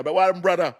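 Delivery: high, loud mock Jamaican greeting; Jamaican accent. Joking about talking to people from Jamaica, mock Jamaican accent. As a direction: put on a playful Jamaican accent. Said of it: "there'll be people like from Jamaica".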